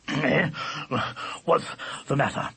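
sleepy, halting yawned question; yawning. A narrator's reading. Dog woken from dreams, yawning. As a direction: talk through a huge yawn. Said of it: "yawning hugely".

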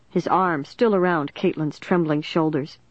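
plain reading voice

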